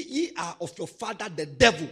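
ordinary talk, nothing marked

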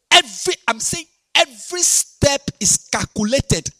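loud, high, slow preaching; fiery sermon shout; preaching at full force. Acted. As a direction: hammer it home to the church. Preaching to congregation.

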